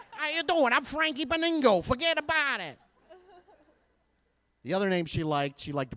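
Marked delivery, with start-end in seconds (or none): 0.1-2.8 high-pitched, hammed-up mobster voice; mafia-sounding kid. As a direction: play a kid talking like a movie gangster. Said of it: "the most mafia-sounding kid in the world".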